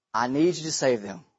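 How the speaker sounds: low, soft, earnest pleading; hushed plea. Acted. Prophet asking God to save the boy, softly pleading.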